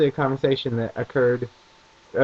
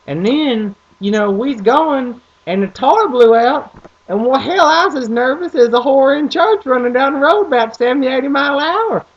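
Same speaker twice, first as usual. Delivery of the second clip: dad's thick Southern twang; 9 semitones higher, 7 dB louder than usual. Retold or imitated. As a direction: tell it like a rural dad spinning a yarn. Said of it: "that's really how he sounds".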